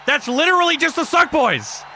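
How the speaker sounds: high, loud repeat; emphatic shout. Real, not acted. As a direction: shout it so they finally hear. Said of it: "It's our sub faction".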